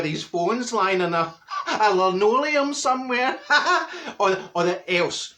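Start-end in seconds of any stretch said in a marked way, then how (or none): 1.7-4.2 laughing through words; giggling at the image. Real, not acted.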